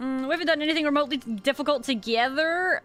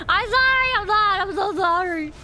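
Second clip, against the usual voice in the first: playful loud apology; 6 semitones higher, 5 dB louder than usual. Pretend.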